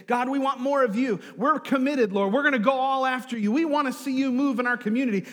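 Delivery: loud, high, urgent plea to God; fervent prayer. Retold or imitated. Hungry congregation praying for revival, loud and fervent. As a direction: pray it out forcefully, pressing on. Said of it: "we start praying".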